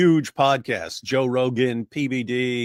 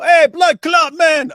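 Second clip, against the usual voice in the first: much higher and louder, mock Jamaican greeting; cheerful shout; 11 dB louder, much slower than usual. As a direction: yell it down the stairs like a cool greeting. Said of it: "and go".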